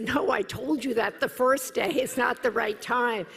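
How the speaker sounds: high, loud impatient scolding; told-you-so retort. Retold or imitated. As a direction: remind him she said so. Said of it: "I said".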